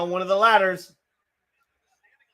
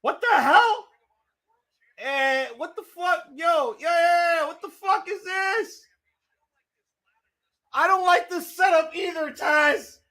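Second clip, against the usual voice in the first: outraged disbelief; 9 semitones higher than usual. Real, not acted. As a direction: shout in shocked disbelief. Outraged at ladder setup.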